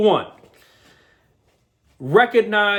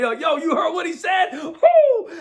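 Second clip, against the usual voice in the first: hyped shout; 11 semitones higher, slower than usual. Real, not acted. Host opening his show with a hyped shout and whoop. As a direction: fire the crowd up with a big whoop.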